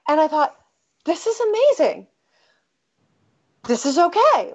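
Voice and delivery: high, light, amazed relief; excited relief. Real, not acted. Recalling the relief when her husband answered, voice lifting high.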